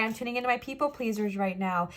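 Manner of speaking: ordinary talk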